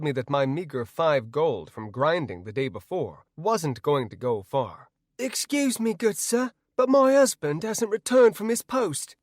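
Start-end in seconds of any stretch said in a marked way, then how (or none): 5.2-9.1 high-pitched pleading woman's voice; high character voice, politely appealing; woman townsperson. A narrator's reading. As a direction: play a townswoman, pitched well up. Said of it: "Anna told the woman".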